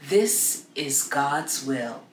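slow and grave, a voice not of this world; solemn divine voice. A narrator's reading. The saints to Joan, a slow solemn otherworldly voice. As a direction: pronounce it as settled fate.

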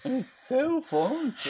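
high, slow mock-posh "so fancy". Teasing the viewer's compliment, high slow mock fancy. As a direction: drawl it like a snob.

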